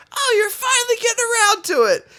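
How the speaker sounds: high mock old-lady jab; mimicking Arlene. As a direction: needle him like a nagging old woman. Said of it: "He's like".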